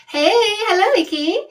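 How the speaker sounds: greeting loudly; bright greeting. Real, not acted. Greets a live viewer by name, excited and loud. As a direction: call out a cheery hello.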